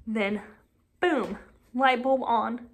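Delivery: ordinary delivery, nothing marked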